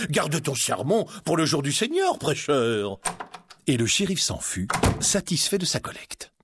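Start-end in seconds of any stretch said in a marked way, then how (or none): 0.0-3.0 sheriff chuckling dismissively; higher, amused mockery; mocking laugh. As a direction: brush him off with a scornful chuckle.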